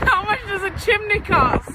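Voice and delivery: excited. Real, not acted. Eagerly pitching the joke.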